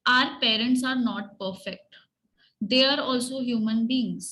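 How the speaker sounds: very slow, word by word; slow teacher dictation. Shown as a demonstration. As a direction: spell it out slowly for the class. Teacher dictating slowly to children.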